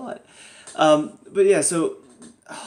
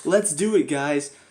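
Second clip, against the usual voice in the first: mock cheerful team; 3 semitones higher, slower than usual. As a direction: parody the team's eager pep. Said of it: "they're treating this like".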